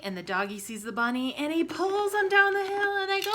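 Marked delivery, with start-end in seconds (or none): 1.3-3.3 much higher and louder, racing along; building excitement. Acted. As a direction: speed down the hill with the kids. Sled racing down the hill.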